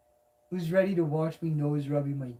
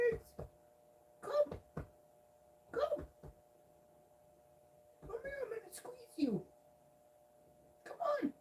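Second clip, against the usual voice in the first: very high soft squeak calling the cat; squeaky pet call; 11 semitones higher, 4 dB quieter than usual. Real, not acted.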